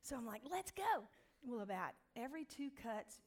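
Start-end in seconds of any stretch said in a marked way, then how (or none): 0.4-1.1 high, loud, eager let's go; excited go-ahead. Retold or imitated. Telling the stylist to start, eager and loud. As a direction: jump in with both feet.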